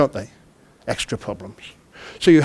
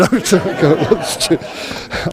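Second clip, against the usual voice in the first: laughing. Real, not acted. Flustered slip during talk.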